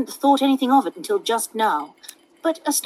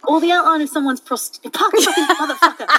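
laughing; 7 semitones higher, 4 dB louder than usual. Real, not acted. Reader cracks up mid-line.

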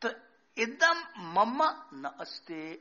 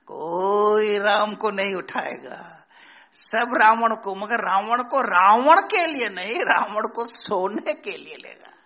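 forceful preaching; 11 dB louder than usual. Real, not acted. Preacher drives home Ravana point.

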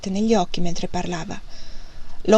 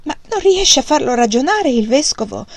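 impatient; 6 semitones higher, 4 dB louder than usual. A narrator's reading. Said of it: "con una certa impazienza".

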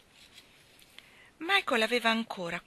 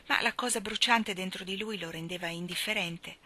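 ordinary delivery

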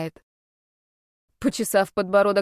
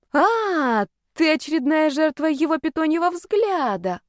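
raised pitch, exclaiming as it dawns; sudden realisation; 7 semitones higher than usual. A narrator's reading. Heroine realises who the girl is, exclaiming knowingly. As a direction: let the penny drop, pitched up.

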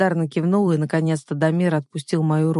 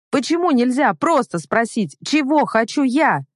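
high-pitched exasperated demand; indignant protest; 7 semitones higher than usual. A narrator's reading. Heroine confronting the controlling man, indignant and high. Heroine continues shouting in quarrel.

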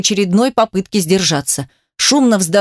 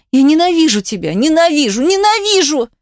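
rising higher with each repeat; distraught outcry; 7 semitones higher than usual. A narrator's reading. Held wife repeats her hatred, increasingly distraught. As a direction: build to a high, anguished cry. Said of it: "простонала она со всхлипом".

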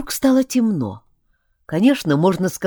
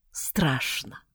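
much softer, small voice; scared murmur; 5 semitones lower, 9 dB quieter than usual. A narrator's reading. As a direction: breathe it out in fear. Narrator frightened. (тихо, испуганно)

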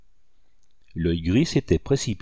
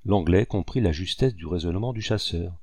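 ordinary delivery

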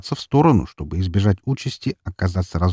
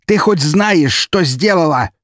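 high, loud, enraged demand; raging, raised-pitch accusation; furious yell; 9 semitones higher, 5 dB louder, slower than usual. A narrator's reading. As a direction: shout the question in fury. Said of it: "заорал он".

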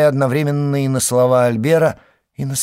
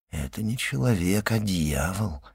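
hushed, voiced near-whisper; 3 semitones lower, 7 dB quieter than usual. A narrator's reading. Albert to himself as his mother sleeps, hushed. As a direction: say it softly under the breath. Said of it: "прошептал он".

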